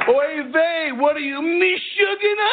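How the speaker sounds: high, whiny Yiddish "Oy vey"; Yiddish accent. Retold or imitated. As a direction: Schumer squeal, way up high. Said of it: "I'm gonna do the voice".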